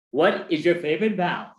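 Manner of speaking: ordinary talk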